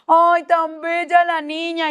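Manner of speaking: halago imitado, agudo y muy alargado; gente de iglesia encantada. Retold or imitated. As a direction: imita a quien se acerca a mimar a una niña. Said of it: "Ahí sí se le acercaban". (voz aguda y lenta de admiración)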